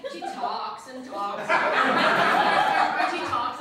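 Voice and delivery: slow, weary repetition of talks; drawn out. Real, not acted. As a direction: drag out every talks. Complaining wife talks nonstop.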